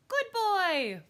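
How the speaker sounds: put-on very high, loud little-kid voice; small child's high exclamation; toddler parroting. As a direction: echo Mommy like a toddler. Toddler parrots his mother.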